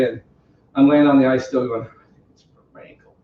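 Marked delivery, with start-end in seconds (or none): 2.3-3.1 whispered, hurt, lying on the ice; pained whisper. Retold or imitated. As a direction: barely voice it, injured.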